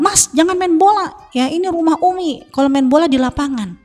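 high-pitched mock telling-off of a child; retold angry scolding. Mother telling her son off for indoor football, mock angry. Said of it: "Pada saat itu saya marah".

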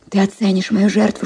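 ordinary delivery

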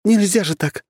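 high, flustered protest; protest, flustered and huffy; indignant huff. Acted. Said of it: "пропыхтела Анастасия".

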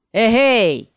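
loud hailing shout; calling out. Acted. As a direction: call out suddenly. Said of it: "вдруг крикнул третий".

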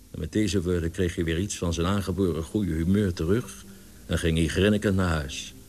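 ordinary delivery, nothing marked

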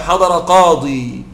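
slow "حضر قاضي" with the final ya kept; keeping the ya. Shown as a demonstration. Teacher showing students the rare form, slow. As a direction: hold the ya at the end of "قاضي". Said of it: "ويجوز قليلًا إثبات الياء".

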